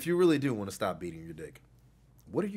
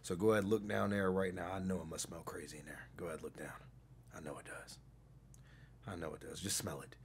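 creepy hushed; 6 semitones lower, 10 dB quieter than usual. Acted. Hushed teasing instruction to caller.

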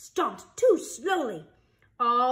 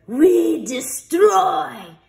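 very slow, loud, higher menace; menacing headmaster; 6 semitones higher, 11 dB louder, much slower than usual. Acted. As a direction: drag out each word like a threat. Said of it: "said the headmaster".

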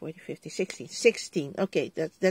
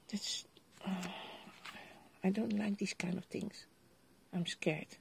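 a soft whispered aside; 11 dB quieter than usual. Real, not acted. A crafter confides to viewers in a whisper. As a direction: whisper it, softer. Dislikes double-sided tape.

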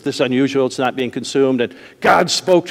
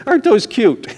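laughing mock; 6 semitones higher than usual. Mocking the dainty dental tools.

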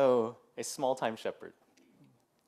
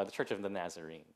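usual speaking voice

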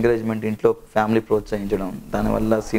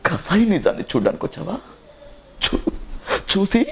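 much higher, intense film-climax monologue, drawn out; Sai Kumar impression; 9 semitones higher than usual. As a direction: declaim a tragic hero's climax dialogue, building to anguished peaks. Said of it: "Sai Kumar గారి voice అంటే".

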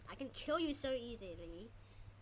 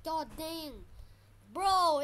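usual speaking voice

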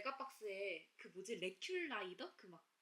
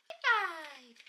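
loud dog command; 7 semitones higher, 14 dB louder than usual. Real, not acted. Dog tricks, repeated command.

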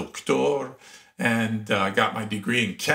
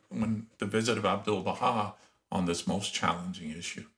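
ordinary talk, nothing marked

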